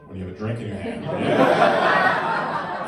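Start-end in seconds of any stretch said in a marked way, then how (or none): none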